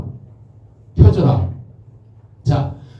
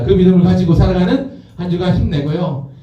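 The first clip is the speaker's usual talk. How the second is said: ordinary delivery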